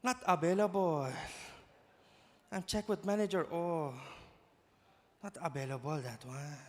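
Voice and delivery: Filipino shop worker breaking bad news; Filipino accent. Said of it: "when a Filipino has bad news".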